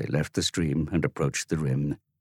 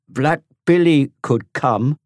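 put-on high, louder, slow boy's voice; ominous offhand reply; 10 semitones higher, 8 dB louder, slower than usual. Tom to Dorian without looking back, slow ominous. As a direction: drop the name like a threat. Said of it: "without looking back".

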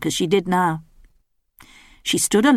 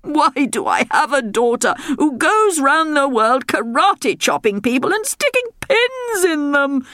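high whining royal moan; moaning king; 9 semitones higher than usual.

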